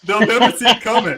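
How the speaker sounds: sly gloat. Acted. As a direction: gloat like a schemer. Gloating about his lecture trick.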